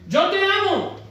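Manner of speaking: high-pitched, insistent lover's excuse; hammed-up declaration with a catch; mock protest. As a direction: plead it high and emphatic, imitating an uncommitted lover. Mocks a half-committed lover.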